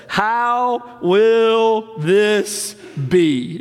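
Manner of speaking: slow, wondering question; Mary's question. Retold or imitated. Mary asking the angel, slow and wondering. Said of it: "Look what she says".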